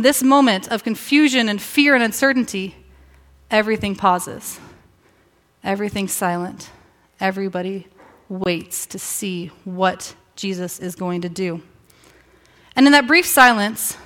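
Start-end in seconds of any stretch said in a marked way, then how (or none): 3.5-11.6 soft, low narration with long pauses; hushed suspense. A narrator's reading. Suspense in a retold silent scene.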